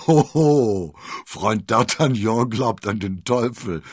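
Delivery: loud, high jeer with a drawn-out hoho; mock your friend with gusto. A narrator's reading. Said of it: "spottete Aramis".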